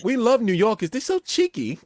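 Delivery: high, put-on Irish girl voice; mock Irish woman. Irish women quoted.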